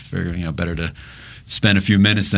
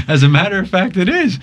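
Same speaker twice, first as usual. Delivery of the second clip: sheepish admission; 11 semitones higher, 4 dB louder than usual. Retold or imitated. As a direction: pitch climbing high, with a laugh. Laughing, admits it's about cash.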